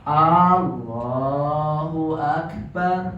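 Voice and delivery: "Allahu" with the first A dragged out; slow, loud first syllable on "Allahu"; wrongly lengthened A. Shown as a demonstration. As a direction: hold the opening vowel of "Allahu" too long.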